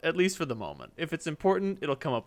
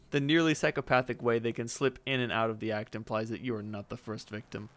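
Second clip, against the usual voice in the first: ordinary delivery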